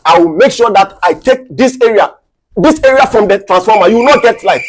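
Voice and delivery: loud, scornful, rising in force; contemptuous warning at full voice; angry threat. Acted. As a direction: threaten the culprit with growing volume. Official threatens to cut area off.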